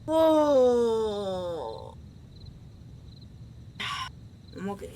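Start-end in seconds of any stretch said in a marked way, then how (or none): 0.1-1.9 long, loud falling moan of relief; wailed "oh". Real, not acted. Player recovering from a scare, wailing moan. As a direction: let out one long falling "oh".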